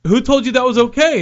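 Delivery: high, loud challenge; mock angry worker. Worker challenging Tommy at the door, high and loud. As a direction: demand it of him. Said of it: "and he's like".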